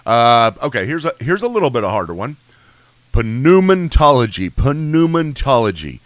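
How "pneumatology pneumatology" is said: pneumatology with the P sounded, slow; P pronounced. Shown as a demonstration. Teacher to class, sounding the P in pneumatology. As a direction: sound the P in pneumatology, puh-numatology. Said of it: "What are you pronouncing the P for?".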